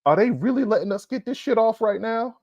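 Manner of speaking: high-pitched, incredulous question; mock disbelief. Host announcing his halftime theme, incredulous and high-pitched. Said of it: "what I call the theme of".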